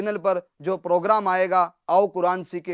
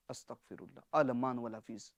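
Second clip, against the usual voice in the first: hushed dismay; 4 semitones lower, 12 dB quieter than usual. Real, not acted. Horrified at the wrong meaning.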